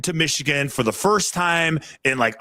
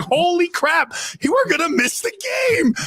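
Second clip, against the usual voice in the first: holy crap panic; 13 semitones higher than usual. Retold or imitated. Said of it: "I'm, like, going".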